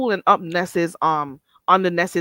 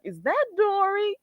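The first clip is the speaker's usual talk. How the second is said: quoting Deanna; 12 semitones higher than usual. Retold or imitated. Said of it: "And Deanna is like".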